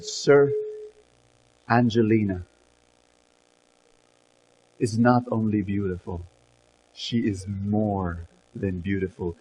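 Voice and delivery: soft, low and tender; calm composure. Acted. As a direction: speak gently, sure of every word. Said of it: "with complete composure".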